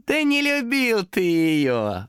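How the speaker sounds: high, very slow, drawn-out taunt; smirking demon. A narrator's reading. Demon denies Томас loved the cat, smirking. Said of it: "ухмыльнулся демон".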